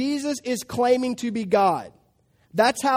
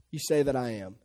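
low and soft, grave; quiet solemn answer; 10 semitones lower, 6 dB quieter than usual. Acted. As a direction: say it calmly and gravely. Jesus' solemn real answer.

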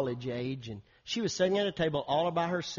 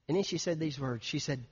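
ordinary talk, nothing marked

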